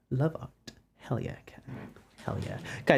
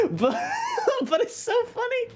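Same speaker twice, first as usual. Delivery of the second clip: laughing hard; slower than usual. Real, not acted. Laughing at embarrassing clip.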